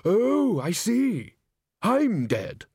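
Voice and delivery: high, startled dawning; surprised realisation. Acted. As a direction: it suddenly dawns on you. Ghost realises he is dead.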